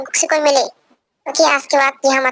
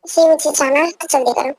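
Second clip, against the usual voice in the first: usual speaking voice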